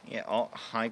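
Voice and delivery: ordinary talk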